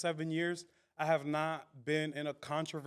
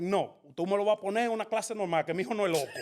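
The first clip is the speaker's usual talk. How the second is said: lightly acted indignant mom, louder and quicker; mock protest in quick, raised Spanish; indignant mother; 5 semitones higher, 4 dB louder, faster than usual. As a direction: imitate a mother firmly refusing. Mother rejecting special class, acted.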